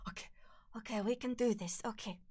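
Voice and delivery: hushed, half-whispered pep talk; quiet self-encouragement. Real, not acted. Streamer steeling themselves for another attempt, half-whispered. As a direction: whisper to yourself before a take.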